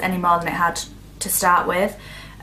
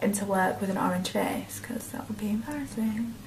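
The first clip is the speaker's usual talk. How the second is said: soft whispered aside; whispering; 9 dB quieter than usual. Real, not acted. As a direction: drop to an unvoiced whisper. Worried aside.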